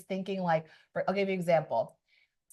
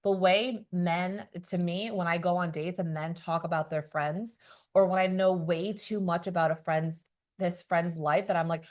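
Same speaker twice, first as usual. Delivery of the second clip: ordinary talk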